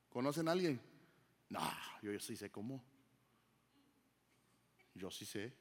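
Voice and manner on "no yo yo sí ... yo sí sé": muttered, softer stubborn insistence; stubborn know-it-all. Retold or imitated. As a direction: play the one who won't take advice, quietly. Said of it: "Hay gente que no toma consejo para nada". (imitando con voz baja a alguien terco que no acepta consejos)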